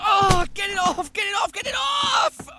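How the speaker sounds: high panicked yells, climbing; panicked shouting. Acted. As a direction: scream to get the spider off. Spider biting, panicked.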